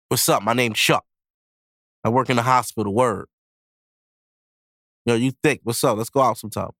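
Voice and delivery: low, laid-back pickup voice; smooth Chuck. Acted. Confident man hitting on a woman, low and cool.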